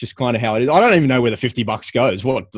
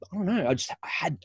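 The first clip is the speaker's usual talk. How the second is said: normal talking voice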